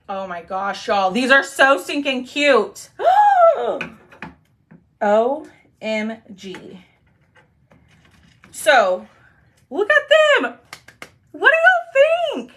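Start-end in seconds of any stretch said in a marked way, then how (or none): 2.9-3.9 sharp high gasp of delight. Real, not acted. Sees finished craft. 9.7-12.5 very high, loud gushing squeal; squealing excitement. Real, not acted. Showing off finished flowers.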